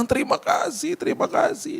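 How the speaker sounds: very high, thin imitation of weeping; high, fading mock-tearful voice; tearful thanks. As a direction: imitate someone crying their thanks. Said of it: "Dia nangis. Dia bilang".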